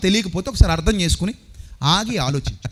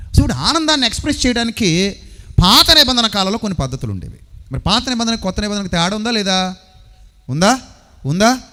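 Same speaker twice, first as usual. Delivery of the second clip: ordinary delivery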